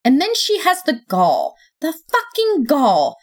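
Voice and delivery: high screeching furious yell. A narrator's reading. Pacing the living room, she screeches her anger to Death. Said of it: "I yelled, letting my voice screech".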